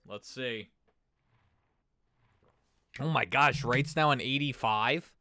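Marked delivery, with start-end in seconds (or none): 3.0-5.1 much louder, higher, amazed; excited exclamation. Real, not acted. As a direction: exclaim in real surprise. Player rating jumped.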